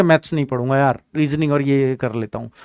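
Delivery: low, soft aside as a student; imitated reluctant student. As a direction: lightly mimic a student opting out, low and quiet. Voicing a student avoiding maths. (दबी, धीमी आवाज़ में छात्र की नकल)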